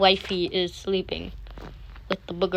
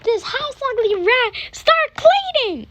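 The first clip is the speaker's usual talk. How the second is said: shrill furious yell, much higher and louder; screaming dad; 6 dB louder than usual. Acted. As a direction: scream it down at her like an angry bully. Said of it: "screams at her".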